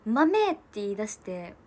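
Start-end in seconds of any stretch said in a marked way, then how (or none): 0.1-0.5 a startling, high, loud bark of one word; sudden loud shout. Retold or imitated. Uncle shouting at the niece reaching for beans, sudden loud shout. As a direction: blurt the word out at full volume, half joking. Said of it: "そのおじさんが急に大きい声で".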